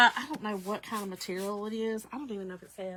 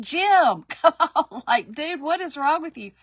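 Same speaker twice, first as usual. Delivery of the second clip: louder, higher plea with laughs; loud teasing nag at her husband; laughing, pleading with a stubborn husband; exasperated plea; 5 semitones higher, 8 dB louder than usual. Retold or imitated. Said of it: "I said".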